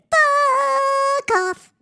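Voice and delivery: very high, cartoonish squeak; squeaky mock-polite voice. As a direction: squeak it out like a sweet little cartoon. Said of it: "a really polite way of saying".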